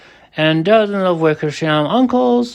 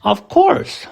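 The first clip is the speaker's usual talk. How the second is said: high, bright eager "Of course"; eager reply; 11 semitones higher than usual. A narrator's reading. Horton promises the mayor, eager reply. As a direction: answer at once with eager warmth. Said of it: "Horton answered".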